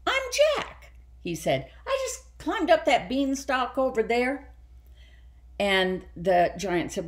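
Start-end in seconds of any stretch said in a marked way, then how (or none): 0.1-0.9 put-on high little boy voice. A narrator's reading. Jack introduces himself. 1.9-4.5 put-on higher little boy voice; light high child's voice. A narrator's reading. Jack's reply.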